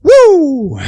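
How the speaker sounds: loud, high falling "woo"; relieved whoop. Real, not acted. Back from the toilet, loud relieved whoop. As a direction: let out a big whoop of relief.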